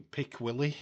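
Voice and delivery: usual speaking voice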